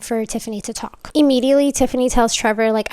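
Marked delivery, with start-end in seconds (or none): none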